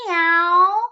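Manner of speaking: high, loud meow; cat meow. A narrator's reading. Dog says meow.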